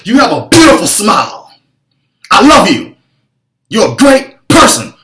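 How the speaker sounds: loud, high, harsh shouted compliment; heard as yelling. Shown as a demonstration. How the coach's praise sounds to a shut-down client, harsh yell. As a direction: blast the kind words so they land like an attack. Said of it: "I believe it sounds like this".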